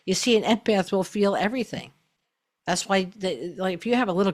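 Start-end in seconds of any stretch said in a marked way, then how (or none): none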